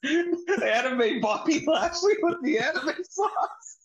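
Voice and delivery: slow, high, drawn-out through laughter; laughing hype. Real, not acted. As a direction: announce it like a giddy ad.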